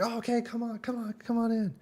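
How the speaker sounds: high mock welcoming host voice; imitating the host. Vlogger imitating the host inviting them in, eager mock voice. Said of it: "he was like".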